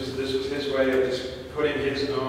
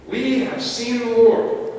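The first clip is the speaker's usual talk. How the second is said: higher, louder and slower joyful news; excited proclamation; 9 semitones higher, 6 dB louder, slower than usual. Acted. Disciples tell Thomas the news, higher, louder and slow with joy. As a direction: announce it with wonder to a doubter.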